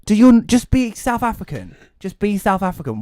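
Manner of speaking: high, exasperated plea to the actor; frustrated rant. Real, not acted. Complaining about an actor's fake accent, high exasperated rant. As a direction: beg him to drop the fake accent.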